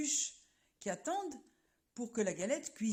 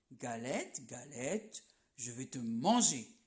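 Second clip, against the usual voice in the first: much lower, growling wolf voice; big bad wolf; 12 semitones lower, slower than usual. Wolf to the galette, deep menacing threat. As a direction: drop low and menace like a wolf. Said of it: "dit le loup".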